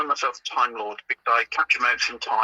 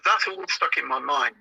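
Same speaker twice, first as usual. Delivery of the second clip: normal talking voice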